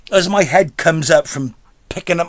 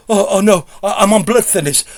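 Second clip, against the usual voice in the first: panicking; 4 semitones higher than usual. Retold or imitated. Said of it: "And she's panicking".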